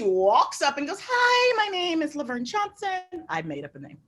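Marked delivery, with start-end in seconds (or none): 1.0-3.0 high-pitched, sweet greeting impression; chirpy customer. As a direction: imitate a perky customer introducing herself.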